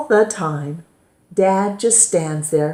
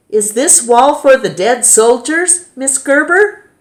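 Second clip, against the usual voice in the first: high, loud schoolgirl question; 8 semitones higher, 6 dB louder than usual. A narrator's reading. Schoolgirl calls out to her teacher, loud and high. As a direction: call it out to the teacher. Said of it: "asks in a very loud voice".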